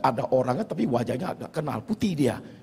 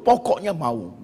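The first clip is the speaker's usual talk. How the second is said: louder, higher mock insistence; demanding; 6 semitones higher, 5 dB louder, slower than usual. Preacher voices the body only making demands, insistent. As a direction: insist on it, pitched up and forceful. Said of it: "Manusia badan kita itu hanya tuntut".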